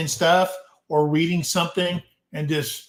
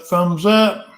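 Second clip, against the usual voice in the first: thumbs up, pushed out louder; 3 semitones higher, 6 dB louder than usual. Shown as a demonstration. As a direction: call it out with more punch.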